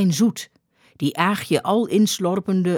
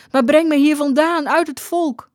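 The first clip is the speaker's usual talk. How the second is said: high, louder urgent plea; begging woman; 9 semitones higher, 4 dB louder than usual. A narrator's reading. Aagje begging the skipper to rescue her, high urgent plea. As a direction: beg to be taken away, pitched high. Begs to be taken away.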